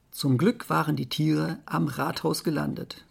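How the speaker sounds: plain reading voice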